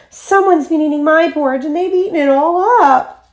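put-on teeny tiny high-pitched bear voice; Baby Bear crying out. A narrator's reading. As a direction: wail it like a little cub. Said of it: "he cried in his teeny tiny voice".

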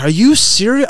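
loud, high angry outburst; lost it. Retold or imitated. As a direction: blow up at the trainee in disbelief. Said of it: "I lost it on this guy".

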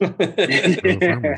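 slow, high mock Iowa "For the farmers"; a drawn-out, hammed-up Iowa "For the farmers"; Iowa accent. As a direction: echo the Iowa catchphrase slowly, pitched high. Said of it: "That's Iowa".

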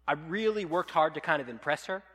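normal talking voice

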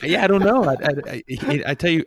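high, loud laughing talk; host laughing along with the guest; laughing loudly. Real, not acted.

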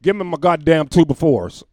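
loud hammed-up rant, laughing through it; mock angry demand. Tyrese acting up at Home Depot, loud mock tantrum. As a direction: play the rich star throwing a fit. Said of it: "acting ass".

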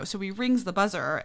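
usual speaking voice

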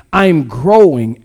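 slow, deliberate "I'm growing"; slow emphatic call. Shown as a demonstration. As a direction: say "I'm growing" like you mean it. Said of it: "say it with me like you mean it".

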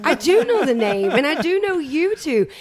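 loud, high excited protest; real excitement. Real, not acted. Guest defending her slip to the host, loudly excited. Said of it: "But I'm just so excited".

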